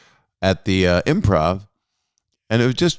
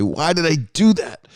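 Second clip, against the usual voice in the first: higher-pitched, mock self-scolding; Chris Farley; 7 semitones higher than usual. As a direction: comically berate yourself, pitch jumping up. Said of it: "Chris Farley".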